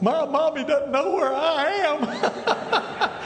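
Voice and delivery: put-on high, slow, whimpering little-child voice; scared little kid. Preacher mocking his own fear at the checkpoint, high slow childish whimper. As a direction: play a frightened small child wanting mommy, half laughing. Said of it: "I was like".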